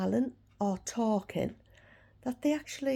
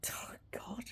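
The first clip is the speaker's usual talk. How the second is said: whispering; 16 dB quieter than usual. Real, not acted. Sigh after yawn, unvoiced.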